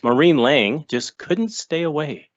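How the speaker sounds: ordinary talk, nothing marked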